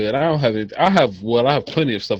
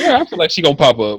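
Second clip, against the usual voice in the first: laughing along; 5 semitones higher, 6 dB louder, faster than usual. Retold or imitated. Half-joking fear of ghost appearing.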